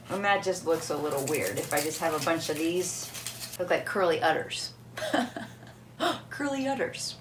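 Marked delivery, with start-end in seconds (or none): 5.1-7.2 higher, softer, through laughter; laughing. Real, not acted. Vlogger amused by her shrimp costume, laughing. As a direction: repeat it while cracking up. Cracking up at own description.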